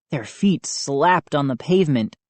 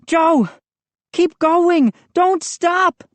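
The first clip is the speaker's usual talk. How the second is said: high frantic shout; frantic cry; 13 semitones higher, 4 dB louder, slower than usual. A narrator's reading. Moose yanking Joe's arm, frantic shout. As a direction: plead urgently, all panic. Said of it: "Moose cried, frantically pulling".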